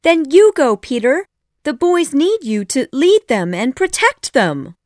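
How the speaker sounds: put-on high, loud, urgent girl voice; Wendy. A narrator's reading. Wendy urges Peter to save himself, high pleading voice.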